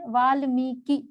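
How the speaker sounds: Valmiki in slow separate syllables; careful demonstration. Shown as a demonstration. Teacher showing the real spelling, Valmiki slowly sounded out. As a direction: draw out मी in Valmiki. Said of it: "वो क्या है वास्तव में".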